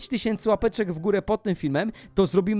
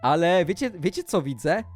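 ordinary talk

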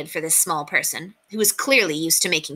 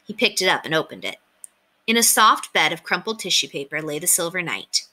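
usual speaking voice

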